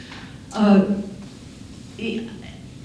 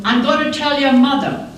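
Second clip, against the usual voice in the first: louder, higher call down the road; mock yell; 5 semitones higher, 4 dB louder than usual. Mother yelling out the window to someone's child, mock yell. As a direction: holler it like a neighborhood mother. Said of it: "yell down the road".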